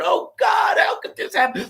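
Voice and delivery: high-pitched, hammed-up emotional distress; mock anguish. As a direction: wail it in mock despair, as if torn apart. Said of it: "She's emotionally torn".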